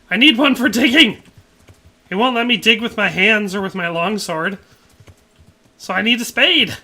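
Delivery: loud, high mock-indignant protest; hammed-up exasperated shouting; exasperated outburst. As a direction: argue back, loud and worked up. Mock argument over needing a spade.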